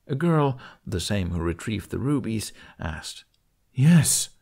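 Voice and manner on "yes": higher, louder eager cry. A narrator's reading. Dragon eagerly answers the girl, loud cry. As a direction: blurt it out happily. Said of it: "Toothless cried out".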